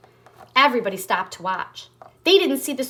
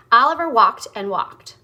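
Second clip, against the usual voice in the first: plain reading voice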